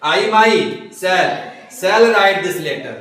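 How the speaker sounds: myself stretched out very slowly and firmly; slow, stressed model. Shown as a demonstration. Teacher slowly models the emphatic myself for students. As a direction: spell out I myself word by word. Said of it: "अब देखो I shall".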